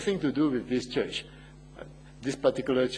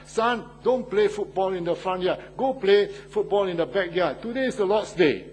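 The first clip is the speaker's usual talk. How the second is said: raised, higher voice of a father calling his son indoors; retold shout; 5 semitones higher, 5 dB louder than usual. Father shouts from the house telling his son to play elsewhere, in a mild mock yell. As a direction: call out to the boy from inside the house, mock-stern. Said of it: "he shouted to his son".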